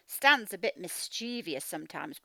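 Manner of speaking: ordinary delivery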